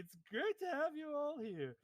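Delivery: high, bouncy clown host; hammed-up cheerful clown voice; jolly clown. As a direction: gush over them like a delighted clown.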